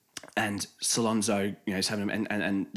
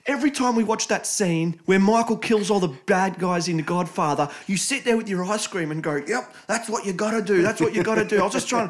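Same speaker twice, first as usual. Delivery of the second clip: high-pitched mock tearful protest; whiny retold self-defence; imitated crying son; 10 semitones higher, 3 dB louder than usual. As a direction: plead like a son in tears, hammed-up. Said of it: "he's crying and that".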